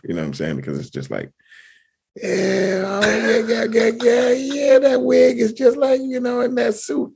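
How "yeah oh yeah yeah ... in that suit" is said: high, sustained impression voice; Herschel Walker. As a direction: hold a thin line way up high. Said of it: "my impression is almost not even there".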